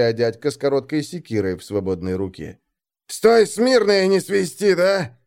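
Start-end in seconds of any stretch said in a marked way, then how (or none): 3.1-5.2 raised voice, cutting him short; curt order. A narrator's reading. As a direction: tough older man's sharp warning. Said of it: "отрезал дядька".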